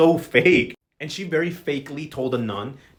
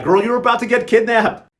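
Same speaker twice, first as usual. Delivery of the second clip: alarmed warning; 7 semitones higher, 7 dB louder than usual. Retold or imitated. Vivi's warning, acted out.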